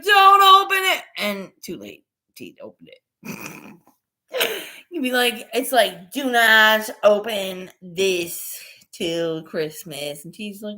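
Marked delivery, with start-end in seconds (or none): 0.0-1.0 loud, high mock yell; frantic warning. 6.1-10.2 slow, word by word; emphatic warning. Acted. Reads out imagined warning label.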